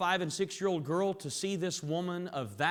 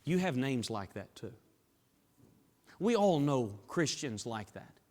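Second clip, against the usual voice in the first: ordinary talk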